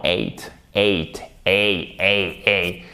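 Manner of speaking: "eight" with gliding ay vowel, low; diphthong demo. Shown as a demonstration. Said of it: "vous avez quand même une diphtongue".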